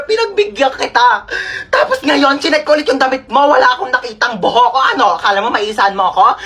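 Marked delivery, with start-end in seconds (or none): none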